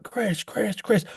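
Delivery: high-pitched mock fan calls; small, high imitation of people calling out; imitated fans calling. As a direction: mimic eager fans calling a name.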